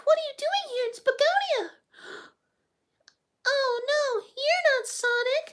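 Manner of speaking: very high, excited cartoon falsetto; gush, then stretch out the surprise; Amy. Streamer playing her mistaking someone for Sonic, high Amy falsetto. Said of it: "Amy's so annoying all the time".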